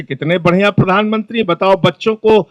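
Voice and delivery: soft, hushed, mock-moved admirer; a hint of a weepy fan gushing; mock tearful admirer. Imitating moved citizens praising the Prime Minister, hushed and soft. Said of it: "कई लोग रोने लगते हैं".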